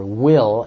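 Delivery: "will" punched out loud and falling; stressing will. Shown as a demonstration. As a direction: land hard on "will". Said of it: "interpret the word".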